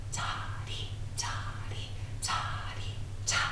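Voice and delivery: whispered rhythm syllables on the beat. Shown as a demonstration. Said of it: "echoing me back".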